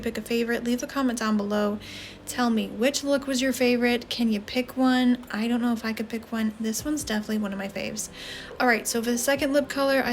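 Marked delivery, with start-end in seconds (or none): none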